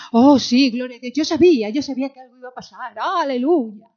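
loud, high hammed-up praise; mock exultation. Preacher mocking those who praise once the wind calms, loud and hammed-up. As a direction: overdo the hallelujah like a fair-weather believer. Mocks fair-weather worshippers.

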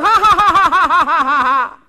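high scoffing question bursting into laughter; loud, hammed-up mocking guffaw; derisive laughter. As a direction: laugh them out of the room. Acts out scoffer laughing at minister.

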